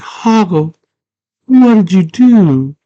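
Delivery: high, loud, drawn-out mock accusation; hammed-up high-pitched reproach; acted outcry. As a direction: wail it slowly at a friend who wronged you. Girl confronts friend over bad fruit.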